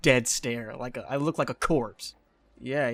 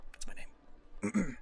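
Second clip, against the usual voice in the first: whispered aside; 3 semitones higher, 5 dB quieter, much slower than usual. Acted.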